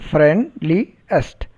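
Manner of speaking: "friendliest" with a gap after friend and after li; three separate syllables. Shown as a demonstration. Teacher repeating the three-syllable demonstration, "friendliest" in spaced beats. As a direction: break "friendliest" into friend, li, est. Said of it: "We produce three syllables".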